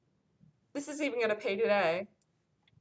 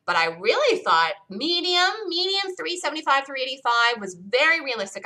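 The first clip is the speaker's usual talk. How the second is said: ordinary delivery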